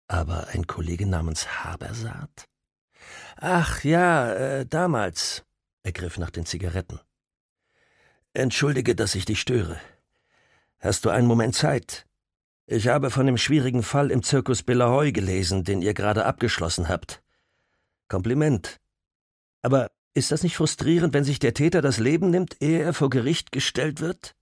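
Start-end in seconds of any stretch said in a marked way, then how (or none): 3.4-5.4 lightly voiced older officer, hesitant; a hint of a friendly old cop; older policeman voice. Caller speaks, older policeman. 8.3-24.3 lightly voiced older officer, hesitant; a hint of a friendly old cop; older policeman voice. Caller speaks, older policeman.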